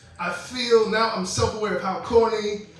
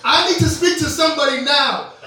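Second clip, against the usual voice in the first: mock Karen; 5 semitones higher, 6 dB louder than usual. Mocking manager-calling women.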